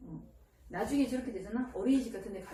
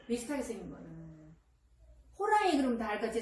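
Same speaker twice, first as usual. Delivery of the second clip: normal talking voice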